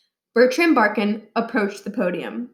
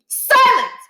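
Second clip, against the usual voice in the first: much higher, very loud roar; furious shout; 8 dB louder than usual. A narrator's reading. Principal shouting down an interrupting student. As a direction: slam the room into silence. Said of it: "slammed his fist onto the podium".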